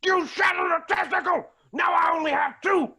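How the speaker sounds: high pained yell; hit hard crying out. Acted. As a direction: yell it, wounded and outraged. Groin shot, yelling at moose.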